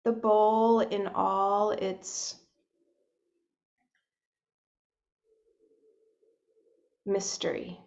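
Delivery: soft, unhurried meditation voice with long pauses; hushed, spacious guidance into breath and body; guided meditation. Real, not acted. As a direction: gently guide listeners inward, leaving space between phrases.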